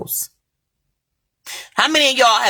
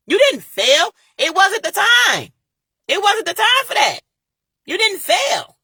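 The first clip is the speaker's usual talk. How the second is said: impassioned pep talk; 11 semitones higher than usual. Real, not acted. Speaking to viewers who feel like failures, fired-up encouragement. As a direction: lift them up, insisting they didn't fail.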